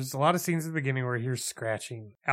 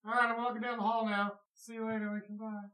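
fading into the distance; 8 semitones higher, 7 dB quieter than usual. Shown as a demonstration. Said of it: "You can be like".

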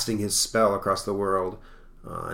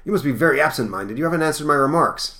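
higher, louder curator voice; acted, high-pitched reproach; scolding curator; 7 semitones higher, 7 dB louder, faster than usual. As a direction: scold him in a raised voice.

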